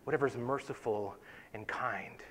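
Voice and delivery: "Whatever's merciful and kind" whispered softly, savouring each word; hushed whisper of "merciful and kind". Shown as a demonstration. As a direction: breathe "Whatever's merciful and kind" like a treasured phrase. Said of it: "I love those words".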